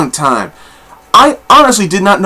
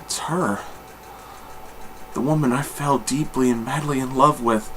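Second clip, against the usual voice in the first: lovestruck hush; 11 dB quieter than usual. Retold or imitated. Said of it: "and he's like".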